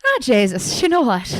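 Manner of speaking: loud, hammed-up Irish accent; best Irish accent. Shown as a demonstration. Podcast host asked for her best Irish accent, loud mock Irish. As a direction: belt out a stock Irish exclamation, pitch climbing. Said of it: "let me hear your best Irish accent".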